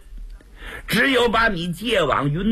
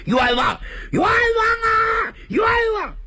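crying out injustice. Acted. Wrongly accused son cries out.

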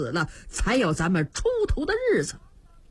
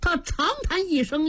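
usual speaking voice

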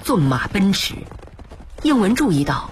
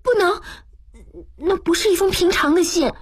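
put-on high-pitched girlish protest; insistent, much higher voice; 11 semitones higher, faster than usual. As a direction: blurt it out in a high female voice. Girl blurts out a protest.

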